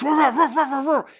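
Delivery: high, rapid-fire woofing; imitated dog barking. Storyteller as the dogs chasing Taily Po, imitated barking. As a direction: bark like a pack of dogs.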